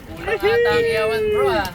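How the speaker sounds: high, loud excited call; excited shout. Real, not acted. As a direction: call out in delight. Reacting at the catch.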